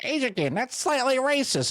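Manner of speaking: high-pitched mock objector; hammed-up squeal of protest; objecting critic. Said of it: "they're gonna be like".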